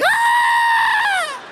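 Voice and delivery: shrill startled shriek; high scream. Retold or imitated. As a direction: scream in sudden fright. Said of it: "I was like".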